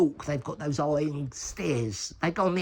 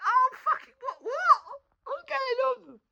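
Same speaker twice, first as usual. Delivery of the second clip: panicked confusion; slower than usual. Retold or imitated. Panicked inner thought retold.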